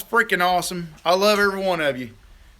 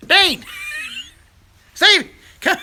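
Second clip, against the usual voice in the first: laughing call; 13 semitones higher, slower than usual. Real, not acted. Calling out laughing.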